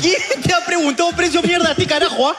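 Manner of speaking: high-pitched indignant tirade; hammed-up angry customer; mock outrage. Acts abusive customer at shopkeeper.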